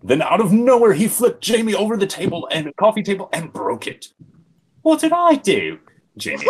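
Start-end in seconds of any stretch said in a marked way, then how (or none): 4.8-5.8 high, loud hurt protest; indignant yelp. Acted. Jamie protesting after being flipped over the table. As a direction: yelp it in disbelief.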